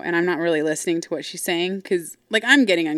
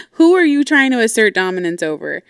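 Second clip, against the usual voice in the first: loud, pitched-up challenge; indignant outburst; 4 semitones higher, 7 dB louder than usual. Retold or imitated. Daughter reacting to mom's hand slam, loud indignation. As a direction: exclaim it in scornful disbelief.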